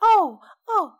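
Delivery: high-pitched imitation of a friend's voice; mock impression. Teasing a friend about how they talk, mock impression. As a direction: mimic the friend with high little ohs. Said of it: "You're very like ... It's kinda like that".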